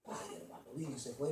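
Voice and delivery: whispered, broken lament; brother's lament. Retold or imitated. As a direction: breathe it out like a heartbroken man. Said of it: "me dijo". (lamento susurrado del hermano abandonado)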